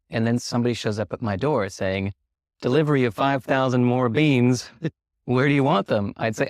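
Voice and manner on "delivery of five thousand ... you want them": mock delivery man. Delivery man arrives with beans.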